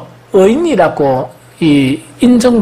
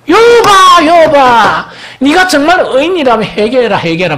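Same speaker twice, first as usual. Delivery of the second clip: loud, high call of Job's name, then a scolding demand; friends calling out Job; 7 semitones higher, 4 dB louder than usual. Retold or imitated. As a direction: call out to him like accusing friends, much higher and louder. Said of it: "막 이렇게 합니다".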